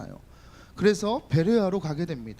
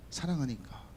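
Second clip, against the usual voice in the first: soft answer; 6 semitones lower, 7 dB quieter than usual. Acted. Pastor explaining jealousy comes from love, soft and sincere. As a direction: lower and softer, sincere. Answering why he gets angry.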